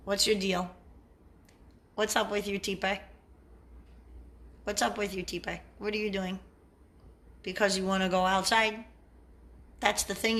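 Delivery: soft coaxing pet talk; talking to the dog. Real, not acted. Owner softly asks dog Tipe if it wants out. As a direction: gently ask the dog what it wants.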